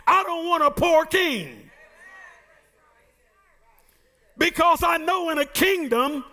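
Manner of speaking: fervent preacher's call, leaning into each line; preaching. Acted. Preacher telling the church what a king must be, fervent preaching. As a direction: drive the point home to the pews.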